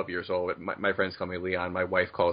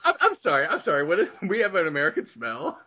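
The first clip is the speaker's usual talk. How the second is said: incredulous; 9 semitones higher than usual. Real, not acted. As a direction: caught off guard and half laughing. Baffled by American smell claim.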